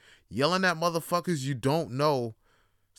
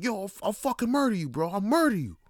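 mock threat; 8 semitones higher, 4 dB louder than usual. Trash talk to office rival.

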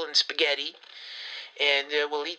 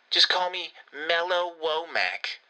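ordinary talk, nothing marked

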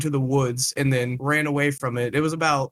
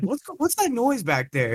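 put-on a much higher, shrill old grandma; 10 semitones higher than usual. Grandma on the phone hearing the motor, much higher. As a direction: play the suspicious grandma. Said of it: "She's like".

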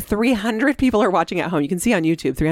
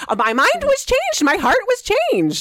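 mocking quote; 14 semitones higher than usual. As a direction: parody his sappy change of heart. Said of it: "'cause he said".

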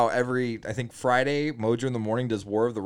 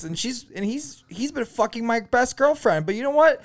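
high, perky morning radio voice; radio DJ impression; 7 semitones higher than usual. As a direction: gush like a chirpy radio host. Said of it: "they're like".

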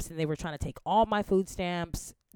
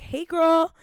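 put-on much higher and louder breezy "Hey, girl."; imitating Sam; 11 semitones higher, 8 dB louder than usual. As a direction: chirp "Hey, girl." like an old friend. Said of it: "She's like".

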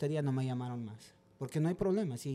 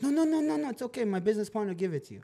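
imitando al dueño, mucho más agudo, con prisa por aceptar; un "no, no, no" nervioso de quien acepta enseguida; inglés chapurreado apurado; 7 semitones higher, 4 dB louder than usual. Retold or imitated. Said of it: "no hablaba muy bien en inglés". (el dueño acepta el precio en inglés roto, agudo y apurado)

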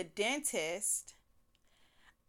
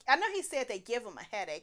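ordinary delivery

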